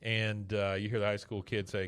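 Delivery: normal talking voice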